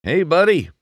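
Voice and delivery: put-on high, loud friendly old racer; booming veteran greeting; the king. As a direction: hail the rookie like a hearty champ. The king's voice.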